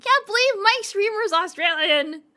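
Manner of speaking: high, loud mock wail; hammed-up horror at Australia. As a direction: cry it out in fake horror.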